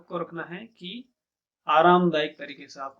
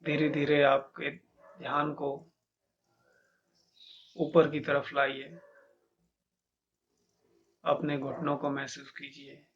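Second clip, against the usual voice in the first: measured meditation guide, long pauses between instructions; guided meditation; 4 dB quieter than usual. Acted. Teacher leading listeners to feel each body part, calm meditation guidance. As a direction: guide listeners calmly inward, growing softer as it goes.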